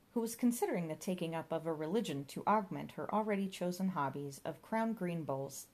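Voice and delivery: ordinary delivery, nothing marked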